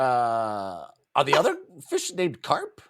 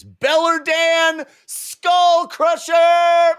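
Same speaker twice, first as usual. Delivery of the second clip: high, loud, slow heavy-metal announcement; hammed-up epic name reveal; triumphant mock shout; 12 semitones higher, 7 dB louder, much slower than usual. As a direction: bellow it like an arena introduction.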